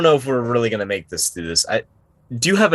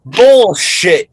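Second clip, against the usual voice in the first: high, loud outburst; loud disbelief; 12 semitones higher, 10 dB louder than usual. Pretend. Player calling out the shaman's claim, loudly disbelieving. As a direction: burst out in disbelief.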